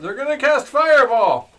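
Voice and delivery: high, loud battle cry; yelled warning. Acted. Game master echoing the player's fireball warning, high yell. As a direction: yell it out with alarm. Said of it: "as he yells out".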